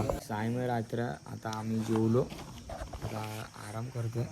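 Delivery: hushed late-night voice; quiet at night. Real, not acted. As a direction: keep it low, others sleeping. Night, others asleep, speaking low.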